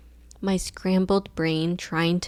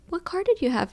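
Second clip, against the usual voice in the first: high lady voice; 10 semitones higher than usual. Retold or imitated. Voicing the lady.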